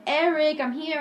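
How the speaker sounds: mom calling out. Retold or imitated. Mom calls Eric at pickup, imitated calling voice. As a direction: call for a kid across the yard, lightly acted.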